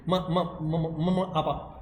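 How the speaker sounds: "म, म" repeated in short stuck bursts before "आपा"; lightly demonstrated stammer, dropping in level; stutter demo. Shown as a demonstration. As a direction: act out a child stammering on the first sound. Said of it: "इस type से बार बार spasmodic type से बोलता है".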